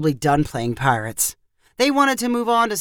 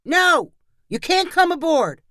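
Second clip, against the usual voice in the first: put-on high-pitched defiant kid's shout; child's shout; 10 semitones higher than usual. A narrator's reading. Boy pirate refusing the policemen entry, shouted high. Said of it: "Amos shouted".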